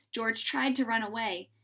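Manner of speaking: ordinary delivery, nothing marked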